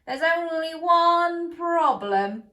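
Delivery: slow, loud, different voice for the chicken; Doctor Chicken. A narrator's reading. Doctor Chicken raising a problem, slow and loud. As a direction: draw it out like a worried hen. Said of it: "said Doctor Chicken".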